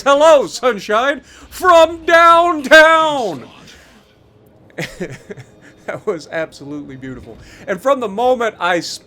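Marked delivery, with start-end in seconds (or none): 0.0-3.5 high, drawn-out victory yell; triumphant shout. Pretend. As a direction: crow it like a sports announcer. Crowing over a long-range hit.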